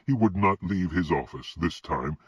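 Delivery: plain reading voice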